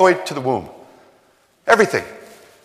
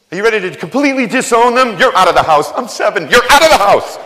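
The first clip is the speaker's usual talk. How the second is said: loud, higher, hammed-up parent throwing a kid out; mock angry parent; 5 semitones higher, 5 dB louder, faster than usual. Parent kicking out a seven-year-old, in mock fury. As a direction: bark it like a fed-up dad, half joking.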